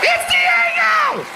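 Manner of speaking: very high, drawn-out shout; excited cry. Acted. Man spotting his pit bull across the park, high excited shout. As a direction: shriek the name in recognition.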